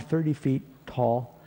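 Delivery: normal talking voice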